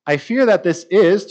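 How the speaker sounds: usual speaking voice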